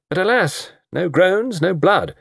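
high-pitched, playful lamenting; lightly theatrical regret; mock lament. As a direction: bemoan it with comic drama. Harry mock-laments lack of murder.

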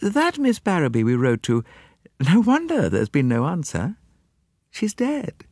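secretary reporting a death without fuss; Miss Lemon voice. A narrator's reading. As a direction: deliver the news bluntly.

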